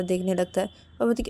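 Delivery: ordinary delivery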